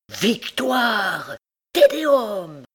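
high, building victory cry; triumphant shouting. A narrator's reading. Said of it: "criait l'écolier".

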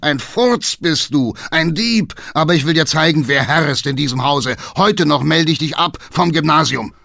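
loud, high, raging tirade; angry shouting. A narrator's reading. Father pulling his son from school, shouting. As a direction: bellow insults and orders at him. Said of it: "schrie der Alte".

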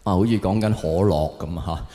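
ordinary talk, nothing marked

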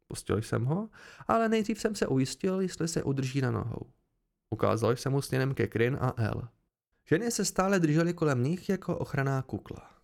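ordinary delivery, nothing marked

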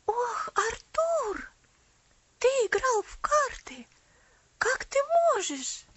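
high, breathy horrified gasps. A narrator's reading. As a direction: recoil in horror. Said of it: "воскликнула она с ужасом".

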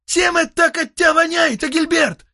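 loud high shout across the room. Acted. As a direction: call it out loud. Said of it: "крикнул Шальк".

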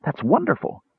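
high, hushed wonder; awed kender delight; gasping. A narrator's reading. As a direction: marvel at it in a high voice. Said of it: "Tass gasped".

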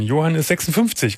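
ordinary talk

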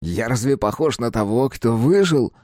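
a dead teenager, mocking; teen boy voice. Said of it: "развел руками Капустин".

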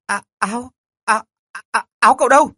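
high panicked shriek; shrieking in shock. Acted. Girl shrieks in panic at the boy, high and loud. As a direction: scream as she finds him on top of her. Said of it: "Con nhỏ la hét thất thanh".

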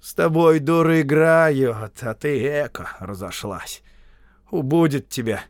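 exhorting, reproachful tone; admonishing. A narrator's reading. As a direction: chide her as if reasoning with her. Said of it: "он говорил увещевающим голосом".

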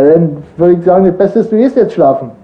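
ordinary talk, nothing marked

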